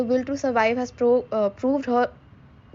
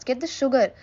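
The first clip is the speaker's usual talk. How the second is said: plain reading voice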